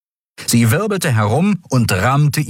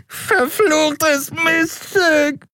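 very high, slow drawn-out wail; a broken-nosed creature yelping curses; very high, stretched howl; howling in pain; much slower than usual. A narrator's reading. Dealer wailing after broken nose.

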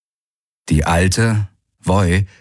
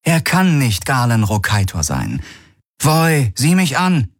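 commanding Ylana; 7 semitones higher than usual. Acted. Ylana commands the old woman.

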